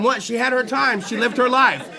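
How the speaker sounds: ordinary talk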